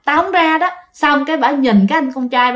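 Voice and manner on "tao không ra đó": defiant ghost. Retold or imitated. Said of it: "bà cười lên".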